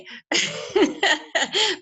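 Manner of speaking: rising laugh. Real, not acted. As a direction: laugh at own joke. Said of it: "asthma pump just to get some air".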